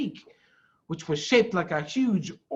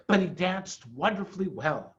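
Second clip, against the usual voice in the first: plain reading voice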